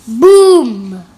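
loud booming shout; big loud boom. Acted. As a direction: shout it like thunder crashing. Reading thunder sound.

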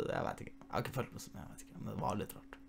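voiceless whispered aside. Real, not acted. Vlogger whispering an aside to the viewers about Baby, barely audible. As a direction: drop to a breathy whisper, half to yourself.

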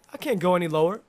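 ordinary delivery

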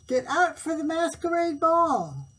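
high, loud summons; eager court. A narrator's reading. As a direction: call the king to the ball. Court's call in story.

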